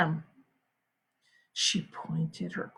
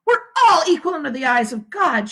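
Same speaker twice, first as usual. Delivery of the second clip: declaring; 6 semitones higher, 4 dB louder than usual. A narrator's reading. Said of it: "she declared".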